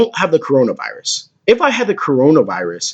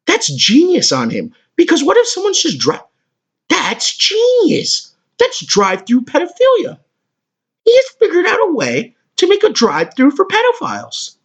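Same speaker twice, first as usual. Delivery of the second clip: much higher, voice cracking in shock; stunned disbelief; 14 semitones higher than usual. Real, not acted. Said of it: "I'm actually kinda stunned right now".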